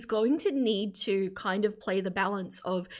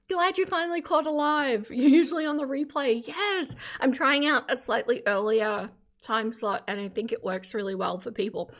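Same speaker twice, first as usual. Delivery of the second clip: normal talking voice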